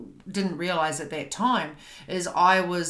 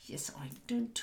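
whispered answer; 3 semitones higher, 9 dB quieter than usual. Retold or imitated.

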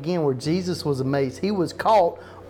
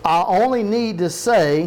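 "I only need to say" higher and louder; emphatic underlined reading; 4 semitones higher, 5 dB louder than usual. Shown as a demonstration. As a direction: drive each word of "I only need to say" forward with force. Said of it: "you see the word underline there".

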